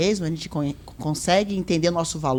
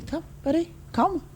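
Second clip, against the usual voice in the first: lightly acted, high-pitched hold-on; high, mildly acted calming; retold calming interjection; 10 semitones higher than usual. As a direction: lift the voice high to halt a friend. Retold calming of an upset friend.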